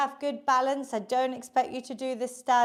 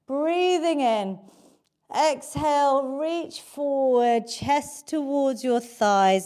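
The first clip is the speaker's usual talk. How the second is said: calm stretch cue; 3 semitones lower than usual. Acted. Leads cool-down stretch.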